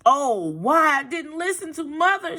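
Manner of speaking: put-on high regretful little girl. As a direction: wail with regret. Girl's regretful thought.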